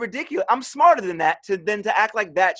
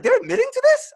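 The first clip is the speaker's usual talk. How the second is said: much higher shocked question; mock disbelief. Hearing Bush's team admit torture, shocked high disbelief. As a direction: gasp it out in disbelief.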